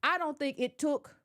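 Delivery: ordinary talk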